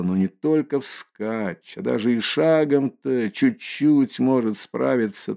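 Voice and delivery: usual speaking voice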